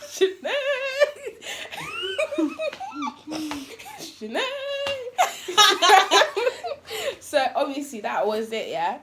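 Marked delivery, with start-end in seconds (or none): 0.0-4.4 high laughing repeated call; high pleading call. Retold or imitated. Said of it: "She's like". 4.5-7.1 shrieking high laugh. Real, not acted. Retelling the funny scene.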